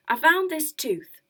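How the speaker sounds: big showman reveal; dramatic reveal. A narrator's reading. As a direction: present the tooth with a flourish.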